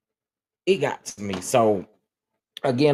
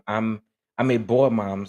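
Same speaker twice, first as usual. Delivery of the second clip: ordinary talk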